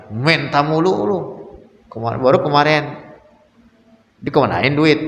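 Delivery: mildly indignant husband, pitch lifted; mock scolding. Husband scolding his wife who asked for money, mock annoyed. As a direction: play at telling off a spouse over money.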